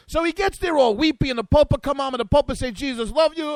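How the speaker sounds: broken English with an Italian swing; mock Italian accent. Host voicing the pope's blessing in a mock Italian accent. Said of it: "I don't know why I'm doing this in Italian".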